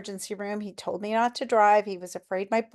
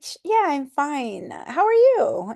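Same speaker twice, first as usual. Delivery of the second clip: high, loud, flustered brush-off; flustered reply; 7 semitones higher, 7 dB louder than usual. Retold or imitated. A stranger asked if I'm okay, flustered high brush-off. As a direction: chirp it back, caught off guard. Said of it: "I'm like".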